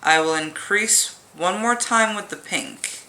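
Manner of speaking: ordinary talk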